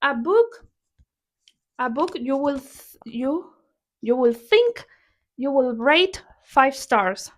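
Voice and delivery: ordinary talk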